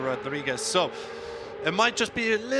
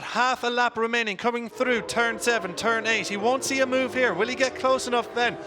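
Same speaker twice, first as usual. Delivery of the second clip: motorsport commentator; 5 semitones higher than usual. Real, not acted. Live race call, final lap.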